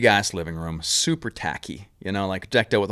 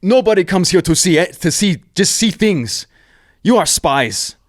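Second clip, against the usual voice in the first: higher, louder, put-on voice of a suspicious governor; hostile governor; 9 semitones higher, 7 dB louder than usual. Governor demanding why the tourists came, imitated in a high, loud put-on voice. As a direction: confront the intruders in your jungle. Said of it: "and he's like".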